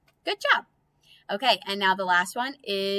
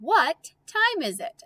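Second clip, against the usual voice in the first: slow reading; 8 semitones higher than usual. Shown as a demonstration. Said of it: "Next one".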